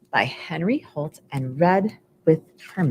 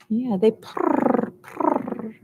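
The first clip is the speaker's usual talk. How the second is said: soft purr sound; 5 semitones higher, 3 dB quieter, much slower than usual. Retold or imitated. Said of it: "they give purrs".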